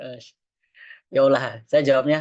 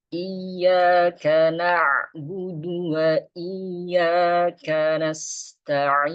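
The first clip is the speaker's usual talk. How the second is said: slow, higher chanted tilawah; Quran recitation; 4 semitones higher, much slower than usual. Shown as a demonstration. Teacher recites the Fatihah verse for the class. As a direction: recite slowly and calmly.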